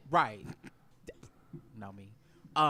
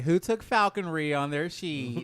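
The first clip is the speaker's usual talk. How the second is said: loud; 3 semitones higher, 9 dB louder, slower than usual. Real, not acted. Loud joke to the table.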